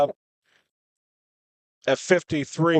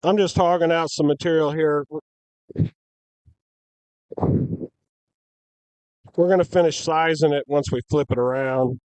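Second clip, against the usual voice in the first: muffled behind a helmet visor; talking through a face shield; inside a helmet. Real, not acted. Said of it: "can you hear me with the helmet on".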